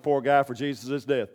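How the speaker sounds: ordinary talk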